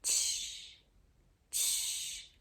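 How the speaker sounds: soft voiceless tch. Shown as a demonstration. Teacher testing for voicing. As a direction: let the students feel no vibration on tch. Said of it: "Faz daí".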